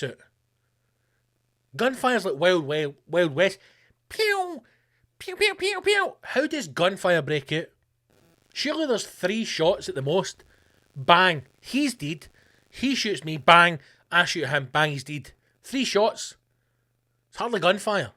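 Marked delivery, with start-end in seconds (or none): 4.1-6.1 very high squeaky gunshot noises; toy gun pews. Retold or imitated. Imitating gunfire. 10.9-15.3 mock bangs. Acting out shootout.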